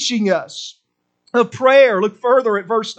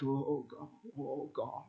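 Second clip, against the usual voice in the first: low moaning; 8 semitones lower, 20 dB quieter, slower than usual. Retold or imitated. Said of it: "meaning moaning".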